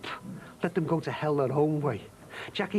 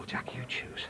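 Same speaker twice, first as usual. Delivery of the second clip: whispered; 7 dB quieter than usual. Acted. Intimate reassurance whispered.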